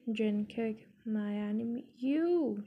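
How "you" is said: higher, louder scornful scoff; a scornful little outburst. A narrator's reading. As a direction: spit it out with disdain. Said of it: "she scoffed".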